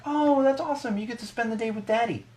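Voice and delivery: high, syrupy mock old lady; sweet cashier voice. Imitating the cashier cooing at the daughter, high and sugary. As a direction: coo at the toddler like a doting grandma. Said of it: "She's like".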